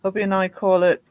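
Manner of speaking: ordinary delivery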